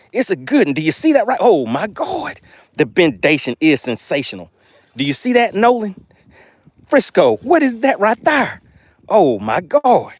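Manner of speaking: high-pitched, excited fish-on cries; thrilled. Real, not acted. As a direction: marvel at the big catch, drawing out the exclamations. Angler hooks a big fish.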